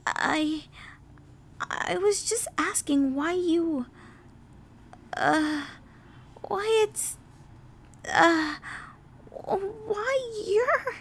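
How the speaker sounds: timid stammering that climbs in pitch; scared. A narrator's reading. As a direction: backpedal nervously, searching for words. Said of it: "Twilight put on a scared expression".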